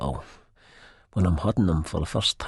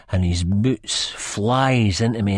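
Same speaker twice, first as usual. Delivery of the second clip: plain reading voice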